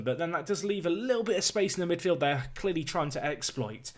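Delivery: ordinary delivery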